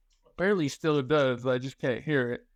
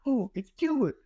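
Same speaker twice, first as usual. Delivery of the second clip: high, giggling reveal; laughing; 12 semitones higher than usual. Real, not acted. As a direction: break into laughter on the name. Confessing prank, laughing.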